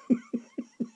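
high, quiet laugh. Real, not acted. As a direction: chuckle at your own absurd story. Laughs at his own story.

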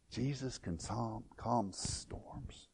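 very soft, slow near-whisper; hushed awe. Real, not acted. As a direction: let it drop almost to a whisper. Awed climax of the point.

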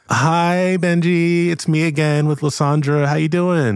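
high friendly hello; cheery greeting. Acted. Kimiko greets Benji, high and cheery. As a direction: greet him sweetly.

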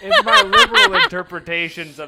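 high, loud, laughing mock pompous. Real, not acted. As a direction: defend it grandly while laughing.

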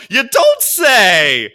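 slow, loud, sarcastic you don't say. Retold or imitated. Mocking a news report, slow loud sarcasm. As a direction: drag out mock shock at obvious news. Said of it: "I was like".

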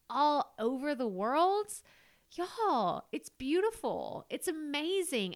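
lifted, delighted praise; excited. Real, not acted. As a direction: voice jumps up with delight. Excited gratitude to listeners.

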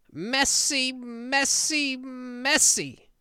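high, loud bumbling magician; hammy stage conjuror flourish; Professor Hinkle. Acted. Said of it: "listen to some Hinkle".

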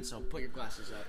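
ordinary talk, nothing marked

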